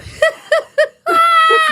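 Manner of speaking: high loud burst of laughter; a loud laughing track cue; imitated canned cackle. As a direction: fire off a canned-sounding cackle. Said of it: "She's like".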